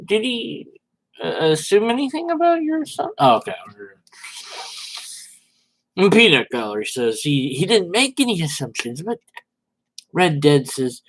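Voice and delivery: normal talking voice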